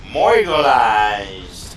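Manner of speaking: slow, loud mock word; loud drawn-out shout. Real, not acted. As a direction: stretch the made-up word out loud. Gamer gets killed.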